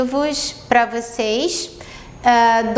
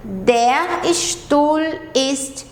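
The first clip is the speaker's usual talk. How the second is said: Der Stuhl ist neu, drawn out very slowly; slow model sentence; much slower than usual. Shown as a demonstration. Teacher modelling German for beginners, very slow. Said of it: "Aí eu trouxe pra vocês".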